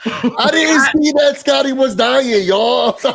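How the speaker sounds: high, loud, breaking into laughter; laughing apology. Real, not acted. Host apologizing about co-host nearly choking, laughing high and loud. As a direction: wail it through helpless laughter.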